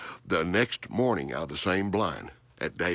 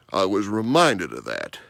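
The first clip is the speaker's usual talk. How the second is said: normal talking voice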